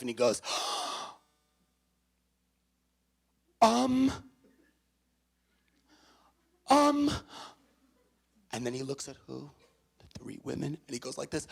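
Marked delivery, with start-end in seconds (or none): 3.6-7.1 high, loud nervous "um"; cornered man stalling for an answer; stalling hum. Retold or imitated. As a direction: hum helplessly while stalling. Matt stammering.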